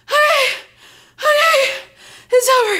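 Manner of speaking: high, squeaky rattled relief; shaken relief. Retold or imitated. Rider at the end of the coaster, shaken high squeak. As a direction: act out trembling disbelief at surviving.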